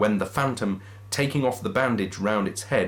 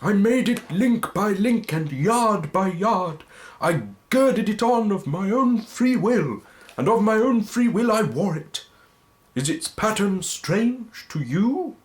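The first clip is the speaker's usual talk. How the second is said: Marley's ghost lamenting to Scrooge; mournful ghost; 11 semitones higher, 4 dB louder than usual. Acted. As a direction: wail your fate like a damned spirit. Ghost speaks of his chain.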